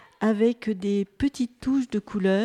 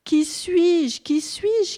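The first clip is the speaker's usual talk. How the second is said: high, louder repeated plea; insistent questioning; 8 semitones higher, 5 dB louder, much slower than usual. A narrator's reading. Muddy creature asks who it is, insistent. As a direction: press the question, pitched well up.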